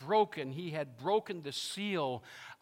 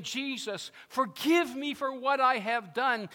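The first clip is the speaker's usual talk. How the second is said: raised, pleading cry for forgiveness; Peter, broken; 9 semitones higher, 6 dB louder than usual. Acted. Peter begging Jesus for forgiveness, broken. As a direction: beg it out high and loud. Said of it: "In his broken way".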